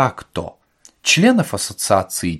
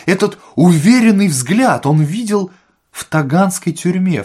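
high, louder, building on the discovery; emphatic revelation; 7 semitones higher, 5 dB louder than usual. A narrator's reading. Administrator finally remembering where he saw Ostap, emphatic. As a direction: announce the recognition with rising excitement.

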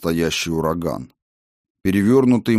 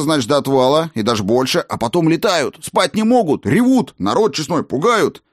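high, lively gnome voice; eager gnome lecturing about dragons; chatty gnome; 4 semitones higher than usual. Acted.

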